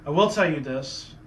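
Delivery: usual speaking voice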